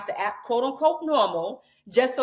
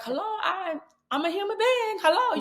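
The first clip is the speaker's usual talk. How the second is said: exasperated mock plea; 10 semitones higher than usual. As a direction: pitch it up like you're waving for attention. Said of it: "And it's like".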